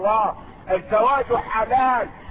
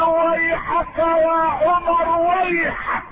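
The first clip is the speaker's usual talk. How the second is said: high, slow, stretched-out cry of reproach; impassioned retold outcry; roaring rebuke; 6 semitones higher, slower than usual. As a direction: cry it out like a lion in a sermon retelling. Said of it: "يصيح كالأسد الهصور".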